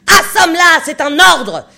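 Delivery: hurlement furieux, très haut et très fort; ordre hurlé. A narrator's reading. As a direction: ordonne en hurlant de rage. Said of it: "avant de hurler". (le capitaine hurle l'ordre au chasseur)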